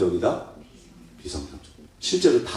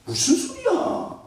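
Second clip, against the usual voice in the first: scoffing retort; slower than usual. Acted. Mocks an unrepentant reply.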